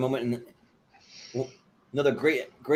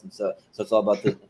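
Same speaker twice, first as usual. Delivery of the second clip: ordinary talk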